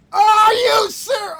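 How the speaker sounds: loud, high outburst of disbelief; real frustrated yell. Real, not acted. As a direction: yell it slowly, incredulous. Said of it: "Sorry that I'm yelling".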